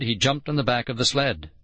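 ordinary delivery, nothing marked

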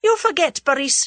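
high, loud imperious woman; indignant, commanding lady; flashing anger. A narrator's reading. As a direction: pull him up sharply. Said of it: "her eyes flashing".

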